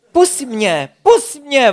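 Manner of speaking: trapped beaver cries out for help; calling out. Acted.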